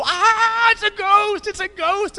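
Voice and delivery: high, loud scream of fright; terrified shriek. Retold or imitated. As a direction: shriek at a ghost on the water. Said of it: "They go".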